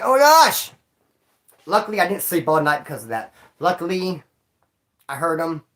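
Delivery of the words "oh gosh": high, loud startled gasp of words; mock dismay. Nervous about missing roll call, a high alarmed exclamation. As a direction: react like you just got caught off guard. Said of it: "I'm just like".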